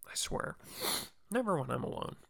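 normal talking voice

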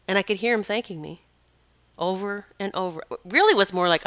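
ordinary talk, nothing marked

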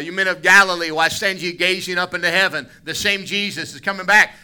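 loud, ringing scripture read, high and strong; preaching scripture. Acted. Preacher to congregation, loud, lifted preaching. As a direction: proclaim it to the whole room.

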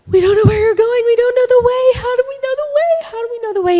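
high, loud, frantic pleading; panicked. Acted. Thomas panicking at Jesus, high and frantic. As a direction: blurt it out in rising panic. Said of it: "in his panic".

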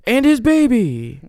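high booming announcer call; fight announcer. Pretend. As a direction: announce it to the arena. Continuing announcer bit.

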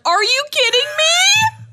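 high, loud, slow disbelief; shocked outrage. Retold or imitated. Mom reacting to her son crying at church, shocked and loud. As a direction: shriek it in disbelief. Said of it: "I'm like".

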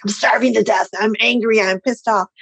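mock grumpy complaint. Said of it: "I was like".

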